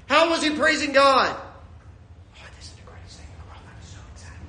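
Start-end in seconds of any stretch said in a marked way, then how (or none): 1.4-4.4 barely audible, toneless whisper of joy; mock-timid praise. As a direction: whisper the excitement under your breath, comically meek. Deliberately feeble praise, then corrected.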